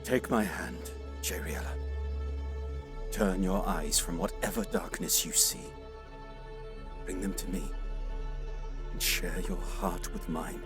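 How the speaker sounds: low, gentle coaxing; tender reassurance. Acted. Warrior offers troubled seer a hand, tender and reassuring.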